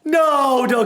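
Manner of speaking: high, loud cries of no; panicked gamer shout; alarmed pleading. Real, not acted. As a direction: beg loudly for it to stop. Pleading as the game ends.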